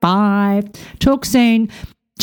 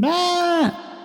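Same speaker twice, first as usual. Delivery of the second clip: mock frustrated yell; 9 semitones higher, 6 dB quieter than usual. Coach acting out chatter getting in, high mock scream. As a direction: let out a long high "aah" of fake panic.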